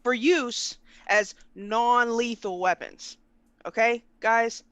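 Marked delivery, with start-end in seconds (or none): 0.0-3.2 drawn-out words with a long pause; slow repeat. Shown as a demonstration. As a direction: finish the slow, deliberate restatement. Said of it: "repeat it again slowly".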